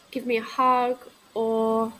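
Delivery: ordinary talk, nothing marked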